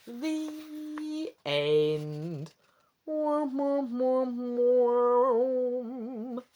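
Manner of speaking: mock sad trombone, drawn out. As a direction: sing the failure jingle. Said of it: "mock sad trombone ending".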